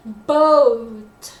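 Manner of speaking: emphasized, falling BOAT; secondary stress on BOAT. Shown as a demonstration. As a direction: lift out BOAT with a firm push. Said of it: "the secondary stress is on boat".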